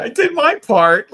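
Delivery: loud, high, stretched-out mock boast; mock proud helper. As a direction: crow it slowly in a high put-on voice. Mocks someone claiming a small contribution.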